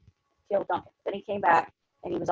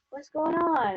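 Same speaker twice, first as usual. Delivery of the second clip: higher-pitched, pretend puzzlement; feigned innocence; 7 semitones higher, slower than usual. Guilty couple coming out of their room, feigned innocence. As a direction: play lightly at knowing nothing. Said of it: "pretend like we don't know anything".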